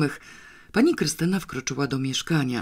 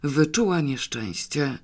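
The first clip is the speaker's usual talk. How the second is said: ordinary delivery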